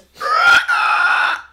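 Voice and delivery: huge, very high shrieking cry; monster roar. Acted. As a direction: roar it out as the giant pumpkin. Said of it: "let out the biggest".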